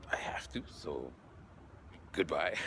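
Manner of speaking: whispered, choked goodbye; soft teary whisper; tearful whisper. Retold or imitated. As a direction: barely get the words out. Teary goodbye speech retold.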